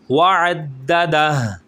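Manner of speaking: slow recitation stressing the shadda; doubled dal. Shown as a demonstration. Teacher demonstrates shadda on وعددہ, slow and deliberate. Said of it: "عددہ نہیں کریں گے کیونکہ شد ہے".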